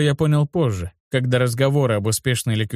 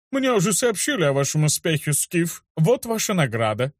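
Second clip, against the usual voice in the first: official's voice; 5 semitones higher than usual. Acted. Councilor speaks to Skif.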